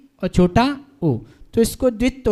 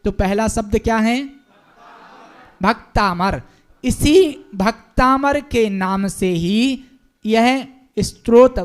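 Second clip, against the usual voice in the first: ordinary delivery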